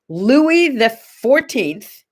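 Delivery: Louis the fourteenth said slowly, each word weighted; slow emphatic. Shown as a demonstration. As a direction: lean on Louis the fourteenth like a grand announcement. Announcing a historical fact.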